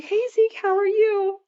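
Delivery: put-on high sing-out hello for a little kid; retold greeting to a child.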